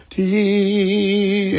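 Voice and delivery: long high wavering trumpet note; high sustained "teee" mimicking a shaky horn; imitated trumpet vibrato. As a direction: sing out a held note with vibrato. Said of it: "used to put a vibrato on".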